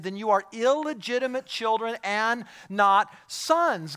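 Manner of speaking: high, loud, impassioned sermon delivery; full-force preaching. Acted. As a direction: preach it out loud with heavy emphasis.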